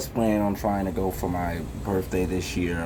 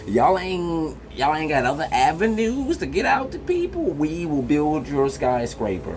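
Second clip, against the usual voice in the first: mock incredulity; 8 semitones higher than usual. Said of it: "and I was just like".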